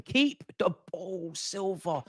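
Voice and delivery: slow, soft, drawn-out groaning plea; exasperated plea. Real, not acted. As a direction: plead slowly, dropping away on the name. Pleading with player to keep ball.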